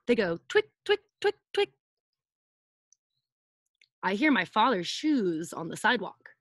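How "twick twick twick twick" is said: crisp clicking sound effect "twick"; imitated toenail scratching. Shown as a demonstration. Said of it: "his toenails scratch the sidewalk".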